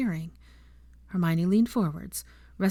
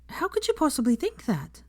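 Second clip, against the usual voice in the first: a higher, indignant demand; 9 semitones higher than usual. A narrator's reading. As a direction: throw the question back at him, affronted. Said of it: "she demanded".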